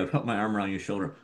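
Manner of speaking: normal talking voice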